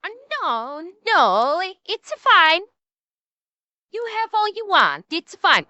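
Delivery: put-on Mexican accent slipping into Italian; badly faked Mexican accent gone Italiano. Restaurant server reassuring confused diners, bad Mexican accent. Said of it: "my Mexican accent went Italiano".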